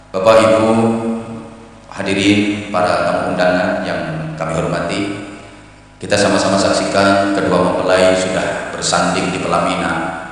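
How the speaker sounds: low, soft, measured ceremonial announcing; wedding MC. Acted. MC addresses wedding guests before the couple, formal and low. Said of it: "kemudian M C-nya ngomong".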